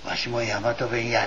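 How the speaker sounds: quiet, sheepish little confession; shy admission. Retold or imitated. Second Shinbashiya head admitting it to the meeting, sheepishly. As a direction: own up to it softly, a bit embarrassed. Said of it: "恥ずかしそうに言わないで".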